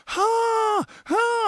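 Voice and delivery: high, stunned double huh; stunned gasp. Retold or imitated. Soldier reacting as the engines turn back on, high shocked huh.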